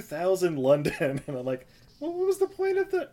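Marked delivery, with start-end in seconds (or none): 2.0-3.1 high-pitched confused question; puzzled high voice. Retold or imitated. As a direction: squeak out the confusion. Retold puzzled thought, high voice.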